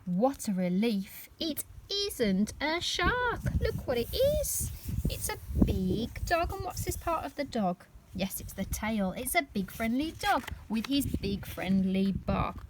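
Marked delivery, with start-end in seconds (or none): none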